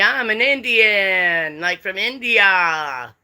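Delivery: hammed-up, drawn-out mock claim; mock declaration. Voicing someone claiming any heritage they like, drawn-out mock declaration. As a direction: stretch the words out long as a pretend boast.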